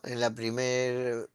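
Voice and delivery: ordinary talk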